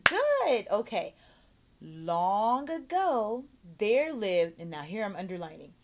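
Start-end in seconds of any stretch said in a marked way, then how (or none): 0.1-0.7 high, loud burst of praise; bright praise. Shown as a demonstration. Demo praise. 1.8-4.6 very slow, louder model reading; reading to a child. Shown as a demonstration.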